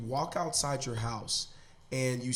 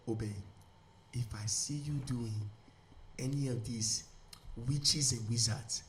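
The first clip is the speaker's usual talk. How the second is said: put-on Nigerian mom accent; 4 dB quieter than usual. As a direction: warn your son in a Nigerian mother's accent. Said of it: "I'm gonna just do my mom's accent".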